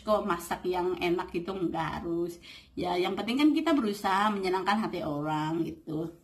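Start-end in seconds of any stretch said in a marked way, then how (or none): none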